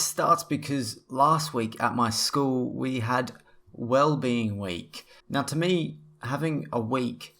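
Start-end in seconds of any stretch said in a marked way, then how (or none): none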